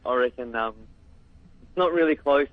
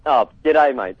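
louder, easy Australian g'day, mate; Australian greeting; 6 dB louder than usual. Shown as a demonstration. Caller demonstrates the classic Australian greeting. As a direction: greet like a true Aussie. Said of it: "That's the classic".

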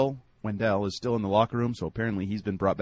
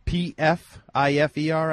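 spelled out slowly; 5 semitones higher, 4 dB louder, slower than usual. Shown as a demonstration. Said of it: "Pfeiffer was spelled".

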